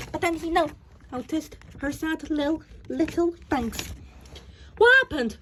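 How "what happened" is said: sudden worried cry, higher and louder; anxious question. A narrator's reading. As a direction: jump in, alarmed for her. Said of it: "he asked anxiously".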